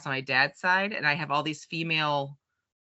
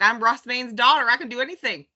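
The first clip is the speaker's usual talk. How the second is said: proud boast; 6 semitones higher, 3 dB louder, faster than usual. Retold or imitated. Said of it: "I was like".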